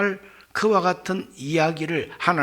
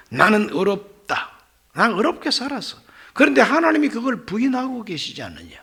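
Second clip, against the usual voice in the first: mildly hammed-up protest of innocence, pitch raised; acted protest; 5 semitones higher, 4 dB louder than usual. Job defends himself before God and friends, in acted protest. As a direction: argue your case, leaning on each claim. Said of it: "그 항변입니다".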